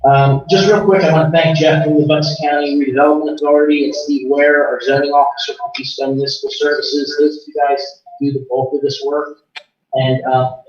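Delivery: ordinary talk, nothing marked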